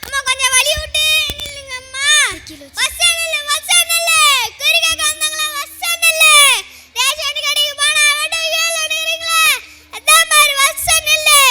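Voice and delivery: high, loud crowd-marshalling call; shouting orders. Acted. Attendant orders fortune-seekers to line up, shouting. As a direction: holler people into line.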